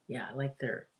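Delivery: ordinary delivery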